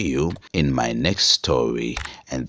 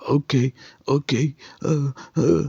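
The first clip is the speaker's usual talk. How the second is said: high, nervous okay okay; scared stammer; 11 semitones higher than usual. A narrator's reading. Young driver answering the demons, high and scared.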